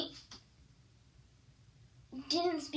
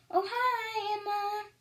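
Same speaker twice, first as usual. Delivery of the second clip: much higher, cheery carefree hello; breezy friend greeting; 9 semitones higher than usual. Retold or imitated. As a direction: greet her sweetly as if nothing happened. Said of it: "she'd just be like".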